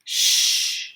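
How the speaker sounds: a sharp breathy shh; boy hushing frightened friends; whispered hush. Acted. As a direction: hush them before they're heard. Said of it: "James whispered back".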